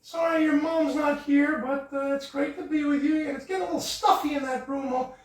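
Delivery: high-pitched frog puppet chatter; squeaky puppet greeting his cousin. Acted. Puppeteer voices Kermit to host, high puppet voice.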